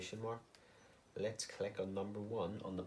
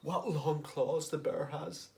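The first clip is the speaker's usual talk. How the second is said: higher, slow, shocked gasp of a line; 5 semitones higher, slower than usual. Shown as a demonstration. Teacher showing year one a shocked exclamation. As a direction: say it like you just saw the claws. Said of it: "If they're really shocked".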